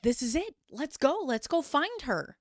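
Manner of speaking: raring to go find her; eager. Retold or imitated. Aaron to the agent, eager and urgent. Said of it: "And Aaron's like".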